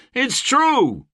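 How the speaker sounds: high heckle from a crowd; jeering shout. A narrator's reading. Mercenaries jeering back at the speaker, high shout. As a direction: shout it out in agreement. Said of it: "jeering and shouts of".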